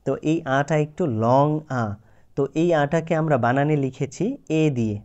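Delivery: ordinary talk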